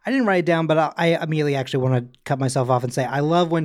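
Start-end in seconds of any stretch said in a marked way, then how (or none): none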